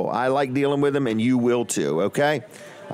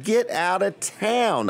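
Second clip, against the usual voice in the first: slow, much higher, amazed; drawn-out amazed exclamation; surprised disbelief; 7 semitones higher, much slower than usual. Retold or imitated. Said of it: "I'm like".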